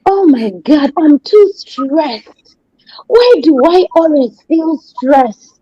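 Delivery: high, slow, put-on whine about being stressed; mock a person moaning they're stressed; whiny stressed person. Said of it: "'cause sometimes we say".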